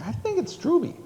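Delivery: high-pitched mock objection; high, tentative little correction; doubtful self-quote. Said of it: "I'm like".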